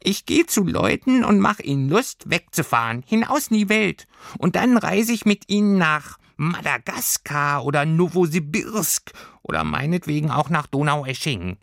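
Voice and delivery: high, chirpy creature voice; a tiny pelt beast explaining its plans; little furry creature. Acted. Creature telling Lilli it is changing jobs, high and chirpy.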